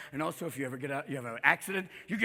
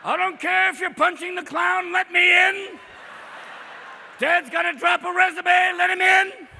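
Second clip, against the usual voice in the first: loud, high, frantic bathroom emergency yell; desperate dad at the bathroom door; 10 semitones higher, 7 dB louder than usual. Acted. Dad after morning coffee yelling to get into the bathroom. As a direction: pound on the door and holler. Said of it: "Dad has his morning coffee".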